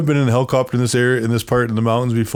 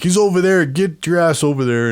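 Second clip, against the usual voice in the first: retold yelling; 6 semitones higher than usual. Amanda sends somebody over to truck, mild mock yell. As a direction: imitate her urgent order, voice pitched up. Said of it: "hear Amanda yelling at somebody".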